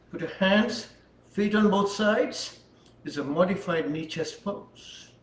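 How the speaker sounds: usual speaking voice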